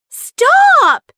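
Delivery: high, loud sharp shout. A narrator's reading. Ollie halts his friends from attacking, shouting high. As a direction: cry out to halt them. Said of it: "shouted Ollie".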